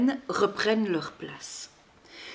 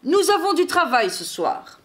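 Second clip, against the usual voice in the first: raised, insistent storybook voice; commanding; 6 semitones higher, 3 dB louder than usual. A narrator's reading. Santa reminding the reindeer of tonight's work, commanding. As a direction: lay down the order firmly. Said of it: "ordonne-t-il aux rennes".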